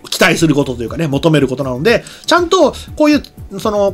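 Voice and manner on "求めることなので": normal talking voice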